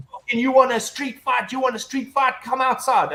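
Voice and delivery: high, heated street-fight challenge; dad squaring up to his son's opponent; angry challenge. Retold or imitated. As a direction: square up and dare him. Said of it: "and he's like".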